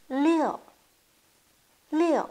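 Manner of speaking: « Liu » haut et fort qui tombe; « Liu » lancé puis descendant; liu quatrième ton. Shown as a demonstration. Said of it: "Quatrième ton". (liu au ton descendant)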